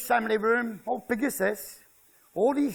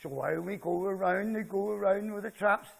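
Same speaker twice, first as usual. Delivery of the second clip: ordinary talk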